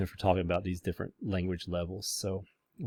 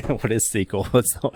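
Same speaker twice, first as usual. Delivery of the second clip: laughing; 3 semitones higher than usual. Real, not acted. Amused at own question.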